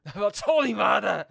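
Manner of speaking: high, loud and put-on; loud mock curse. Streamer offers chat a Chinese curse, shouting.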